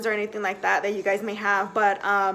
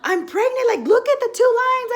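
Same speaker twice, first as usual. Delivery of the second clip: high, loud excited gush with a laugh; telling grandparents, high and loud; freaking out; 13 semitones higher, 5 dB louder than usual. Retold or imitated. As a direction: blurt the news, giddy and high. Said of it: "I started freaking out".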